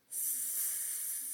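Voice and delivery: S- hissed long and unvoiced like a snake; drawn-out s like a snake. Shown as a demonstration. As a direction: hiss the S- like a snake. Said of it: "I sound like a snake".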